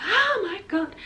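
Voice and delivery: high-pitched retold cry of pain; pained gasp. Patient as the needle goes in, pained cry. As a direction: gasp it high, reliving the jab.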